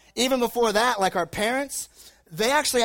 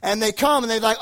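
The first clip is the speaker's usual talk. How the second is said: ordinary talk, nothing marked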